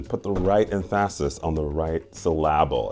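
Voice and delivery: "emphasis" and "syllable" stressed on the middle syllable; deliberately misplaced stress. Acted. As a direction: say em-PHA-sis for "emphasis", syl-LA-ble for "syllable". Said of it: "If you know that reference".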